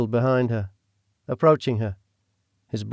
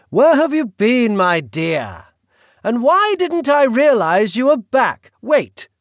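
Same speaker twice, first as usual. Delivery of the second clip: British villain voice; 12 semitones higher, 7 dB louder, slower than usual. Acted. The Duke speaks.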